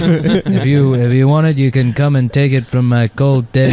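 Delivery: lightly voiced tough-guy actor; defiant, understated movie hard man; Charles Bronson. Host playing Bronson defying the family, light impression. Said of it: "I was doing the Charles Bronson voice".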